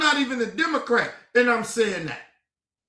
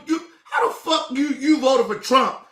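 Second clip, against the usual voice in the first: ordinary talk, nothing marked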